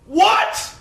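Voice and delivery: very high, loud mock yell of disbelief; hammed-up commentator meltdown; losing it. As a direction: cry out in comic horror, drawing out the words. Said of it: "Cole lost his shit".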